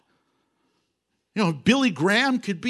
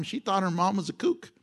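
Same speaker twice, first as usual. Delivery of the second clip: ordinary delivery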